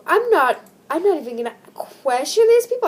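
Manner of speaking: loud, high disbelief; incredulous. Real, not acted. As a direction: throw up your hands at these people. Reacting to creepy story.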